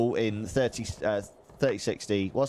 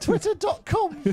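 put-on high old lady voice; squeaky grumbling granny; 3 dB louder, slower than usual. Granny voice teasing.

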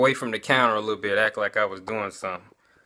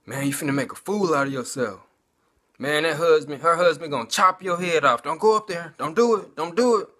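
mocking, nagging voices, pitched higher; a hint of jeering demons, rising to insistent; taunting demons; 4 semitones higher than usual. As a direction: needle him like a demon whispering doubts. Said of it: "Demons got the yapping in my head".

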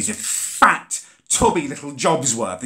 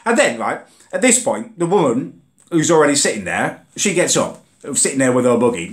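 normal talking voice

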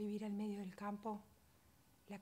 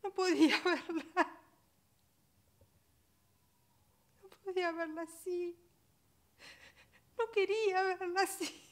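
loud, high, sobbing outcry; anguished breakdown; 9 semitones higher, 10 dB louder than usual. Acted. Mother who cannot look at her still baby, anguished breakdown. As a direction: cry it out in raw grief, pitched high.

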